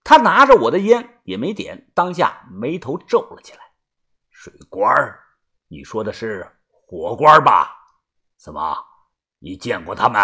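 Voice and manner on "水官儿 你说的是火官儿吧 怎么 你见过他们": low, suspicious elder voice; wary old man. Acted. Grandfather frowning at a strange name, wary. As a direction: question cautiously in a deep old voice.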